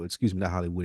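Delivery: normal talking voice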